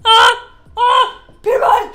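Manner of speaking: high, very loud fan squeals; excited shouting. Real, not acted. Fan calling out to Peter Mon, excited shouting. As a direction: shriek the name with real excitement.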